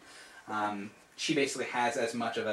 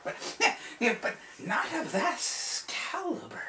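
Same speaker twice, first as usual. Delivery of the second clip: very high, loud, squeaky wizard voice; excited old wizard; 11 semitones higher, 7 dB louder than usual. Acted. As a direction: gush like a frantic little sorcerer. Saul explaining the spell.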